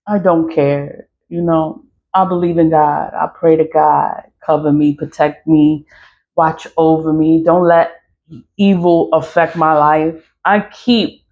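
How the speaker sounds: ordinary talk, nothing marked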